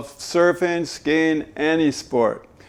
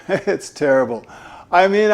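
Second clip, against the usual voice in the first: ordinary delivery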